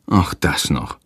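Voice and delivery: low, weary groan; resigned grumble under the breath. Acted. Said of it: "stöhnt Hans".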